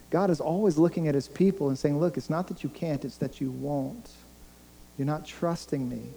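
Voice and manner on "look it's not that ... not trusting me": softened voice, speaking as God; hushed, deliberate correction; quiet reproof. Acted. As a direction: drop the volume and reprove them gently. Voices God addressing his people.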